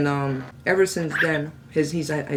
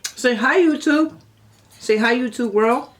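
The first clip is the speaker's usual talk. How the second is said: high coaxing pet talk; 7 semitones higher, 6 dB louder than usual. Real, not acted.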